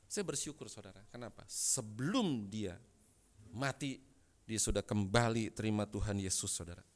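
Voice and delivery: normal talking voice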